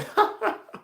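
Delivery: high, airless burst of laughter; a sudden breathy laugh of disbelief. Retold or imitated. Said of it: "And I was like".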